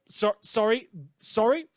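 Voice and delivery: quick, flustered sorry, rising; catching a slip, embarrassed; flustered apology. Real, not acted. Host correcting a slip to listeners, flustered apology. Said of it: "I shouldn't have said that".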